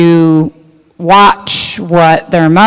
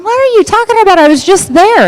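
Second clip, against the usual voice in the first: startled disbelief. Retold or imitated. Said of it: "The guy said".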